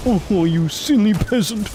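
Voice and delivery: high, haughty snooty noble; aristocrat voice. Acted. Streamer showing off aristocrat voice to chat, haughty. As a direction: sneer down at the peasant. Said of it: "You like my aristocrat voice?".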